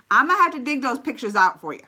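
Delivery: ordinary talk, nothing marked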